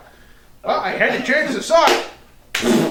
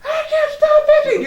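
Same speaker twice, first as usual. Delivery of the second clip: much higher, laughing frantic whine; hammed-up high-pitched complaint; mock whine; 4 dB louder than usual. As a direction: wail it like a desperate itchy victim. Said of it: "you're just like".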